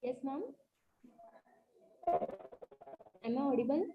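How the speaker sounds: very soft, far from the mic; speaking too softly to hear; barely audible. Real, not acted. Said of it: "Your voice is very low".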